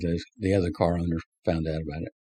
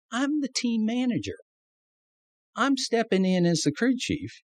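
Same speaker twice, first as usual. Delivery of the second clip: exasperated protest; 13 semitones higher than usual. Retold or imitated. Retold protest to official.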